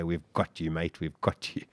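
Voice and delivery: low, soft reassurance; retold rescuer's voice. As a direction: speak low and softly to someone rescued. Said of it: "I just heard this voice say".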